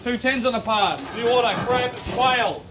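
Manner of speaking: high, loud chef's call; kitchen order callouts. Real, not acted. Chef calling orders.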